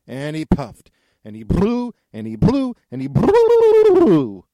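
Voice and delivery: high, loud, slow blown wail; long blowing howl. A narrator's reading. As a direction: stretch it into a huge blow. Narrator acts straining blow.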